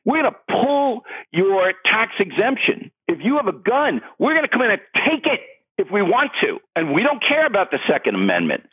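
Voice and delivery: hammed-up imitation of a candidate laying down the law; mock Beto O'Rourke. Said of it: "who are saying".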